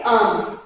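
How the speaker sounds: आम as English "arm" with the r dropped; low, falling आम, r left out; silent r. Shown as a demonstration. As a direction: answer with a long-vowelled आम, no r. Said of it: "आम का pronunciation क्या है".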